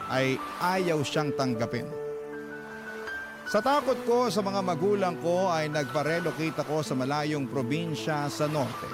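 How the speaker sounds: ordinary talk, nothing marked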